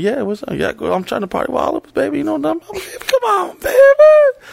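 hammy pleading flirt; mock flirty party voice.

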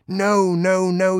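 loud, high dog yelp; high howling protest; yelped refusal. A narrator's reading. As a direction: yelp it out at full volume. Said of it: "yelped the dog".

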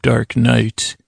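normal talking voice

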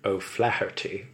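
ordinary talk, nothing marked

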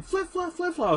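very high, falling squeals; high squeaky quote. Retold or imitated. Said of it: "He's going".

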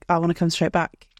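normal talking voice

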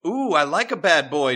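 loud, high swooning voice; a courtier swoons over the traitor, loud and high; flirty courtier. Acted. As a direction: coo it like a smitten fan. Lusty court member.

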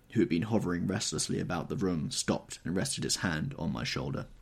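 usual speaking voice

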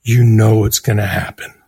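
low, slow, soothing; calm publicist. Retold or imitated. The publicist calming him, low and slow. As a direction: talk him down gently and slowly.

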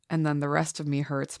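ordinary talk

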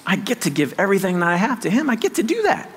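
ordinary delivery

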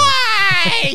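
squealed high and loud hi; an ex leaping out with a delighted hi; shrill surprise greeting. Retold or imitated. Ex-girlfriend greets him, acted.

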